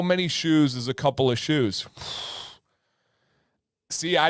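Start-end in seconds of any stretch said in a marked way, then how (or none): 1.9-2.4 long airy sigh; soft puff of air; exhale. Real, not acted. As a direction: blow out a breath, stumped. Stumped by the shoe question.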